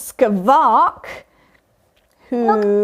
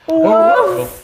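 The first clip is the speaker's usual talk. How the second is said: dog bark; 6 semitones higher, 4 dB louder than usual. Pretend. Dog bark after leash joke.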